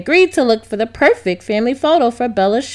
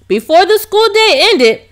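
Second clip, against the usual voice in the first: usual speaking voice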